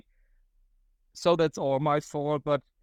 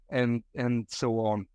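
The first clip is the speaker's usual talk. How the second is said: ordinary talk, nothing marked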